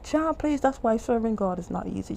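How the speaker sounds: usual speaking voice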